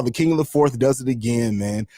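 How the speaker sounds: normal talking voice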